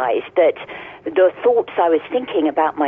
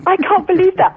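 surprised; 5 dB louder than usual. Real, not acted. Surprised at shared Dublin insult.

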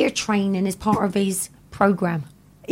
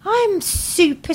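high and slow super; high drawn out; 11 semitones higher, much slower than usual. Acted. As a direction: stretch it high. Cohost overplaying how well she is.

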